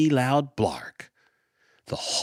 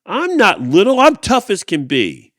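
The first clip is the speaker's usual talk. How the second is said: loud, higher defiant shout; 7 semitones higher, 10 dB louder than usual. Acted. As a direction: yell it, insisting he's not little. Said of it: "Fluff shouted out".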